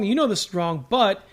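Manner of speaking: ordinary delivery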